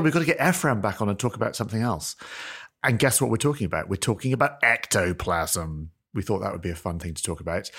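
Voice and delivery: ordinary delivery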